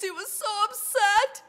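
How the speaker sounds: high, loud, upset wail; lamentable voice. A narrator's reading. Narrator telling how upset the king was, acted lament. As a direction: let the upset push the voice up high. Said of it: "cried with a lamentable voice".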